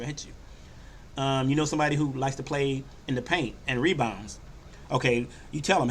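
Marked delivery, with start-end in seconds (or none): 0.0-1.2 slow breathy whisper; whispered promise. Retold or imitated. Point guard whispering a slow promise to his shooter.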